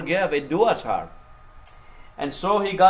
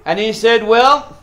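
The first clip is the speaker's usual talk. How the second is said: higher, louder pulpit storytelling; full-force preaching; 6 semitones higher, 7 dB louder than usual. Real, not acted. Preacher tells congregation of Joseph testing his brothers, full-force preaching. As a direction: drive the story out loud and high.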